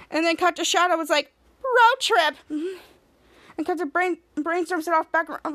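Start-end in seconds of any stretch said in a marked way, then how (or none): 1.6-2.4 high but shorter, lighter echo; smaller cheer. Retold or imitated. Shadow echoing the team, lighter high cheer. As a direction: pipe it up with less force.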